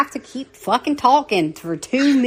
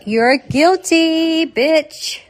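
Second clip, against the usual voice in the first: slow, higher playful accusation; mock verdict; 8 semitones higher, 5 dB louder, much slower than usual. Real, not acted. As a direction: pronounce him guilty with a grin. Playfully convicting Mark.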